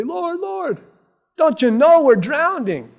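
high-pitched mock cry for help; panicked plea. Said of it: "like the disciples, in the middle of the storm".

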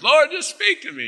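very high, loud mock plea; mock pleading. Mocking a believer begging God with a Bible at hand, squealed high. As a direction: squeal it out like a desperate seeker. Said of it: "they have a".

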